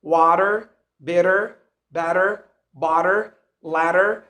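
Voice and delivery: "Water, bitter, better, butter, letter" each ending in a sounded R; American R curled at the end of "better" and "letter"; American rhotic R. Shown as a demonstration. Teacher listing examples for learners, final R pronounced. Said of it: "we have to pronounce the R in the end".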